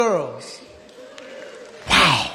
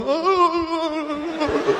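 awed gasp; 9 semitones higher than usual. Retold or imitated. Kids gasping at wall.

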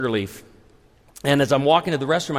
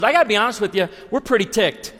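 higher-pitched mock complaint; ticked-off customer; 7 semitones higher, faster than usual. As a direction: imitate an annoyed diner. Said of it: "They're mad".

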